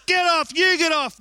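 high, loud get off; struggling shout. Retold or imitated. Husband telling wife to get off, loud and strained. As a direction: push her off with your voice. Wrestling over the ham.

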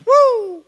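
high, loud mock "woo"; excited whoop. Retelling a passenger's excited whoop.

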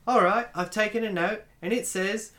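high Australian assistant voice; Australian accent. Retold or imitated. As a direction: imitate an Australian Siri taking dictation. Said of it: "I have it set to the Australian accent".

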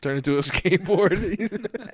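slow, laughing through the words. Real, not acted. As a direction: chuckle it out slowly. Laughing repeat of friend's joke.

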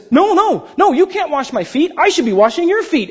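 high, pleading Peter voice; a hint of a panicked Peter; Peter protesting. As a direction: protest like a flustered disciple. Peter protesting in retold scene.

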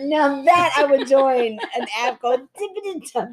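high excited tipsy giggling. Real, not acted. Excited drunk banter.

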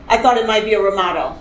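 normal talking voice

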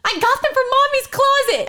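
high, loud imitated yell; screamed down stairs. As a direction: holler it down to someone below. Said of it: "I screamed down the stairs".

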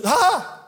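high, loud "ah" of protest; mock wail. Preacher acting out Jeremiah's words to God, hammed-up cry. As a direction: cry out like a dismayed prophet, hammed-up. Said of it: "He said these brilliant words".

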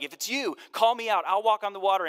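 a keen disciple calling from the boat; bold eager call. Retold or imitated. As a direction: call out across the water, full of nerve. Peter's bold call to Jesus.

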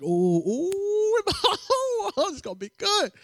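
high giggling ooh; high-pitched gleeful laugh; gleeful giggle. Retold or imitated. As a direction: giggle with delight at the trouble. Gleeful at a coming fight.

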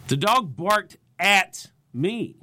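slow, deliberate line, "at" punched out loud; stress on AT. Shown as a demonstration. Comedian demonstrating inflection for each set, stress on "at". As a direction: set "at" apart and lean hard on it. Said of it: "Pick a different word in your jokes to put inflection on".

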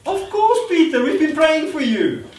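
high, loud mock excitement. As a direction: burst out as if you knew all along. Imagined believers feign no surprise.

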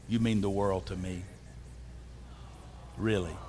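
low, intimate husband's voice; heartfelt sweet talk. Shown as a demonstration. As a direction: drop deep and mean it tenderly. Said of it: "Say it like you mean it".

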